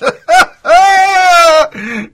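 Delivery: a burst of loud, high laughter; big laugh. Real, not acted. Host cracking up at the shark joke, loud laugh.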